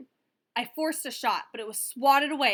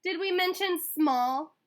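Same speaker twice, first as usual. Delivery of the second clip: high, slow teasing jab; mocking taunt; 7 semitones higher, 5 dB louder, slower than usual. A narrator's reading. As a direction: rub it in with a sneer. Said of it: "added a third".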